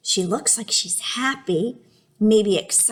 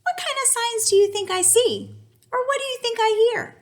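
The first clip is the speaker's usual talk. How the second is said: high, playful questioning voice; mock puzzled voice; 13 semitones higher than usual. Teacher to class, a high playful question. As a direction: pitch it up like an eager pup. Teacher mimicking a dog's view.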